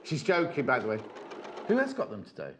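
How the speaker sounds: ordinary delivery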